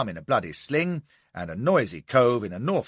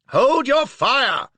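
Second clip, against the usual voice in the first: very high, loud, slow command; urgent shouted order; 14 semitones higher, 8 dB louder, much slower than usual. Acted. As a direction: bellow the order. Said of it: "I shouted".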